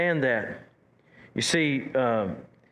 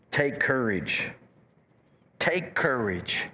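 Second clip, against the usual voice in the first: slow and gentle; 3 semitones lower, slower than usual. Shown as a demonstration. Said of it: "he's throwing it at 'em".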